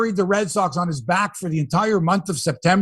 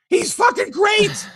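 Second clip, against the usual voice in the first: excited shout; slower than usual. Real, not acted. Raving about Springer.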